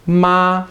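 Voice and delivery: "Ma" high, level and unwavering; steady first tone. Shown as a demonstration. Teacher saying the word for mom, high steady tone. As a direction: hold "Ma" on a high even pitch. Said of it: "your voice just stays steady".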